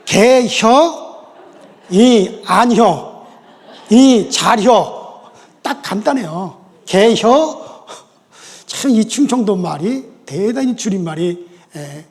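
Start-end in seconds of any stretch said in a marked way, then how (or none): 0.1-5.2 very slow, drawn-out Chungcheong 개혀 with a laugh; 충청도 사투리로 느릿느릿; Chungcheong dialect. Shown as a demonstration. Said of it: "충청도 줄임말로". 6.9-7.9 very slow drawn-out Chungcheong 개혀; 충청도 사투리로 길게; Chungcheong dialect. Shown as a demonstration. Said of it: "충청도 줄임말로".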